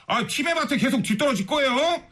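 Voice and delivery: hammed-up urging; mock scolding. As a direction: lecture the company bosses in pretend exasperation. Mock scolding of navigation company bosses.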